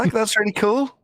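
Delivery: put-on high-pitched parody of young uptalk; mock upward inflection. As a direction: push "really cool" up high as if asking. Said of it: "They say everything with an upward inflection".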